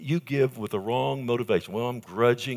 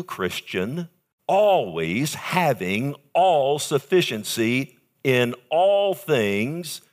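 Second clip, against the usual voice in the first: fervent preaching; 3 semitones higher, 4 dB louder, slower than usual. Real, not acted. Preaching verse at full force.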